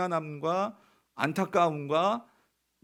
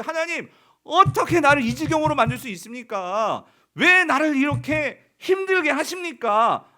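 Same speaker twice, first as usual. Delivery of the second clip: protesting cry to God; 6 semitones higher, 7 dB louder than usual. Retold or imitated.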